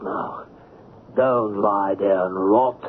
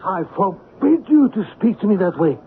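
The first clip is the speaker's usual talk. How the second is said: indignant rebuke; 9 semitones higher than usual. Acted. Chief rebukes his assistant.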